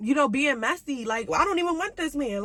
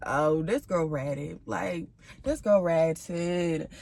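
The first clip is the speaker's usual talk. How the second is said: drawn-out, much lower, very slow disbelief; 6 semitones lower, much slower than usual. Retold or imitated. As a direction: stretch every word slowly, low and unimpressed. Realizing the girl snitched.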